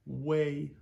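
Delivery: "Way" high and loud, one clean glide; model syllable. Shown as a demonstration. Teacher modelling "way" for students, high and loud. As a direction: say it clearly for them to copy. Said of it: "pronounced like the English word W A Y".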